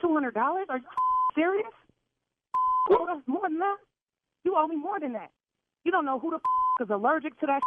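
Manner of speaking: angry. Real, not acted. Furious rant at the caterer.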